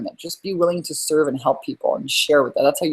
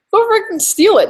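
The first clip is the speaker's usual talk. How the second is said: excited shout; 7 dB louder than usual. Real, not acted. Urging listeners to copy headlines.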